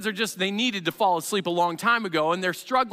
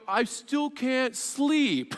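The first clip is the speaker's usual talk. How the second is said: put-on whiny kid; 3 semitones higher, much slower than usual. Child returns, still awake.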